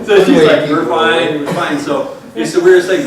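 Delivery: loud, talking through laughter; storyteller still laughing at his own joke. Real, not acted. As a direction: keep the laugh in the words.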